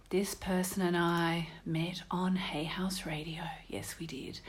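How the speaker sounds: hushed confiding whisper, low and breathy; playful stage whisper to the listeners. Acted. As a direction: lean in and whisper it like a secret.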